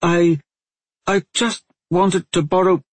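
high, halting plea; flustered excuse. A narrator's reading. Girl caught stealing, stammers excuse.

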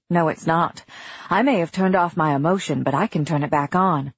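plain reading voice

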